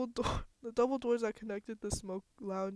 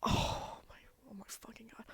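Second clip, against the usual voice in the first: breathless whisper of disbelief; shocked whisper; 3 semitones higher than usual. Real, not acted. Gamer seeing the body, whispering in shock. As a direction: breathe it out, stunned.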